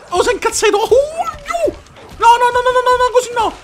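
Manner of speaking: high squeaky falsetto whine; mock squeaky voice. Gamer squealing at the enraged opponent in a high falsetto. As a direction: squeal it like a frightened cartoon. Mock voice reacting to angry enemy.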